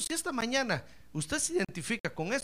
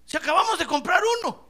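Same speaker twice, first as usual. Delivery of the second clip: mock complaint; 10 semitones higher, 8 dB louder, faster than usual. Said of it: "Y dicen".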